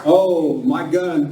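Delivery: usual speaking voice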